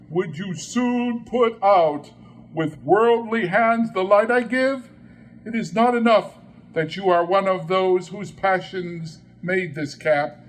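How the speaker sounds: indignant ghost. Acted. Ghost rebukes Scrooge.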